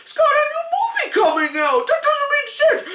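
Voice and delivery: high, fast whiny bafflement; mock confused fans. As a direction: imitate a befuddled fan. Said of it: "people were like".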